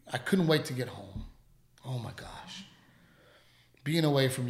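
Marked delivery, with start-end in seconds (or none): 1.8-2.6 breathy near-whisper, soft and low; whispered sigh. Real, not acted. Missing the family after a long week, a soft whispered sigh. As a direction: let it slip out as a whisper.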